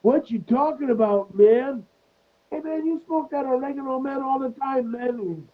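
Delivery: very high, squeezed stoner voice; Chong. Retold or imitated. Quoting Chong answering Cheech in the sketch, very high mock protest. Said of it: "And then Chong is like".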